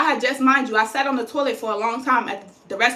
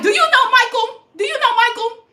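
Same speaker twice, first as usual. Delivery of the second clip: high, pushy name-drop challenge, said twice; pointed demand; 10 semitones higher, 3 dB louder than usual. Retold or imitated. To the paramedic refusing the gurney, pushy high demand. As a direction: lean on it like you have connections.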